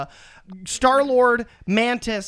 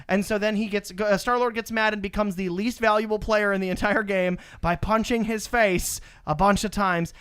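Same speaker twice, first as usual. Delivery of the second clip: normal talking voice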